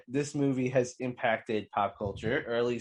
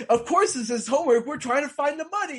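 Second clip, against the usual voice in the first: higher-pitched exasperated outburst; exasperated dude, voice pushed up high; mock exasperation; 10 semitones higher, faster than usual. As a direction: blurt it out in retold annoyance. Acted exasperated Dude in retold scene.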